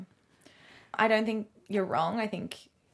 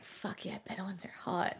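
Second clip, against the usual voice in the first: hushed whisper, much softer; whispered glee; 10 dB quieter than usual. Retold or imitated. Host quietly gushing over Bedouin heroes, whispered. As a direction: breathe it out like a secret. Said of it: "And I was like".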